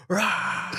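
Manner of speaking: high-pitched mock roar; imitated yell. Imitating son locked in instructor's legs, yelling. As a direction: let out a struggling kid's yell. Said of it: "like, yelling the whole time".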